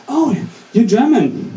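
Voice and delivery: high, drawn-out surprised reaction; mock impressed. American student learning the speaker is German, mock impressed and high. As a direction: stretch it out with exaggerated interest.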